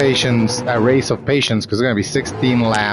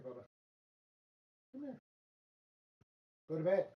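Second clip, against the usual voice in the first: much softer, far from the mic; calling off-mic; 6 semitones higher, 25 dB quieter, much faster than usual. Real, not acted. Streamer away from the mic, calling his cat Fish to come. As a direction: call the cat from across the room.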